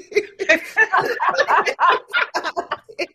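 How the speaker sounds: loud, high burst of laughter; big laugh. Real, not acted. Mom laughing at calling it Chinese food, loud laugh. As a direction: laugh out loud at your own trick.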